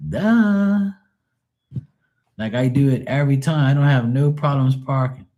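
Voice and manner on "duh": mocking duh. Real, not acted. Mocking bad parkers.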